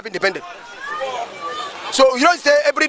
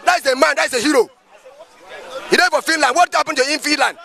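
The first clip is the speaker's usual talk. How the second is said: ordinary talk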